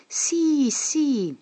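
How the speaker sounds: put-on high, eager voices of small kids answering; little children's voices. A narrator's reading. Children answering their teacher in chorus, high and eager. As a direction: chirp it like a crowd of tiny children. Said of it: "Molte vocine risposero".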